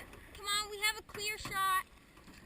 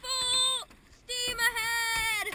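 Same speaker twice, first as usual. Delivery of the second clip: loud, slow, higher battle cry; drawn-out rally cry; 4 semitones higher, 9 dB louder, much slower than usual. Real, not acted. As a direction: stretch it out like a charge. Racing, rallying the paddlers.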